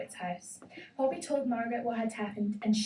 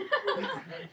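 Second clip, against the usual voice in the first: high laugh; 13 semitones higher than usual. Acted. Elephant laugh.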